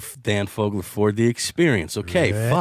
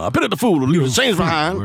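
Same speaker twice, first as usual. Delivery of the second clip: higher, louder tough-guy catchphrase; Mister T; 6 semitones higher, 3 dB louder than usual. Shown as a demonstration. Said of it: "Mister T always said".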